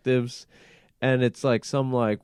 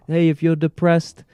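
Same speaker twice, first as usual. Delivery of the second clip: lightly put-on British accent, raised a little; a hint of mock British accent; British accent slip; 4 semitones higher, 4 dB louder than usual. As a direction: imitate a friend but slide into a British accent. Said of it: "now I'm doing the British".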